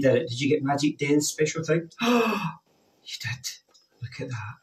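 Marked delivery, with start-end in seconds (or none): 1.9-4.5 gasping, wide-eyed amazement; hammed-up astonished gasp; mock gasp of surprise. Box reveal to children.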